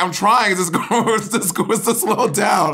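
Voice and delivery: high, laughing imitation of floundering; mock struggling. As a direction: act out a flustered protest, amused. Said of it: "Bob is like".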